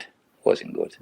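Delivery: low, whispered repeat of It wasn't good; muttered under breath. Real, not acted. Ex-player repeating his verdict on his spell, whispered to himself. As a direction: drop to an unvoiced murmur, as if to himself.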